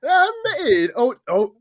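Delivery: very high, loud squeaky cartoon voice that breaks off; Toad. Co-host botching his introduction to listeners, attempted Toad voice. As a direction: squeak it like a cartoon mushroom, then falter. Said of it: "Were you doing a toad over there".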